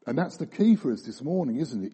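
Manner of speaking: ordinary talk, nothing marked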